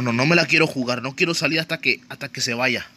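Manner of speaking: usual speaking voice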